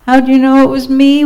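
high, quick mild wail. A narrator's reading. Turkey, found out by Sheep, wails. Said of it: "wailed Turkey".

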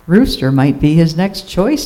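plain reading voice

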